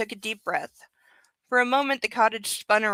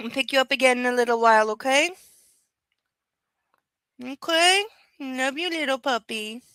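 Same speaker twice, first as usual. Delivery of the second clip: cooing pet talk; 3 semitones higher than usual. Real, not acted. Soothing the dog.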